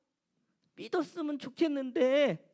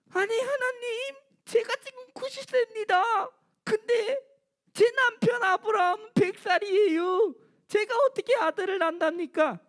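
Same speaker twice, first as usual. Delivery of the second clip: put-on high, whiny voice of old Sarah, much higher; Sarah laughing; 9 semitones higher than usual. Sarah protesting to God she is ninety, high and whiny. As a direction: protest with a tearful half-laugh. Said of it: "또 웃는 거예요".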